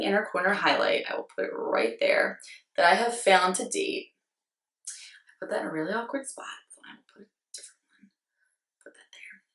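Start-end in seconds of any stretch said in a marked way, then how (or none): 4.9-9.4 very soft, near-whispered mumble; barely audible, talking to yourself; muttering to self. Real, not acted. Vlogger swatching in an awkward spot, muttering to self.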